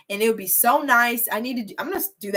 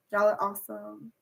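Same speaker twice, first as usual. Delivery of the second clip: normal talking voice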